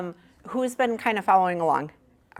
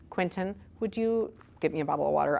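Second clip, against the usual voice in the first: ordinary delivery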